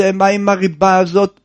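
normal talking voice

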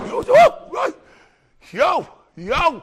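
very high, loud yo yo; excited whoop. Real, not acted. Wild reaction in the crowd. (קריאות התלהבות גבוהות)

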